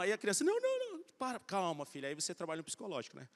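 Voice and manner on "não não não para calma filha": sudden high squeal of mock panic; a scared little girl yelping, imitated; hammed-up frightened child crying no no no; panicked child. Daughter panicking on the bike.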